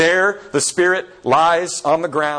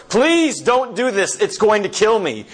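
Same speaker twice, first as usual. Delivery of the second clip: desperate plea; 9 semitones higher than usual. Retold or imitated. Spirit begging the angel not to kill it, high and desperate. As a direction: plead for the lizard's life in panic.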